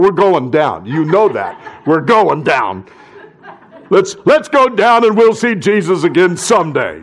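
laughing mock despair; half-laughing resigned sailor; Thomas. Thomas resigns himself in the boat, half laughing. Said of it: "Thomas must have been saying".

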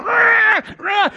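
high screeching cat cries; high-pitched mock feline wail; imitated cat yowl. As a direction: screech like a cat stroked backwards. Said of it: "the cat's going".

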